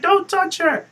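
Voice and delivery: high, loud imitation of a protesting commentator; mock protective outcry. Host imitating commentator defending Eva Marie, mock outrage. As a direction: cry out in mock alarm.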